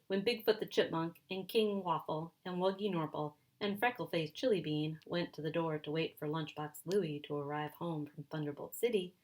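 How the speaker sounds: plain reading voice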